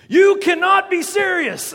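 high, loud outraged protest; John McEnroe. Retold or imitated. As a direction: explode at the umpire's call. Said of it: "more like John McEnroe".

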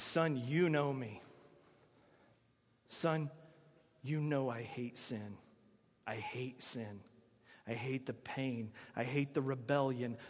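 low, hushed and grave voice of God; God speaking to Son. Acted. God confides hatred of sin to his Son, low and hushed. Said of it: "and God says".

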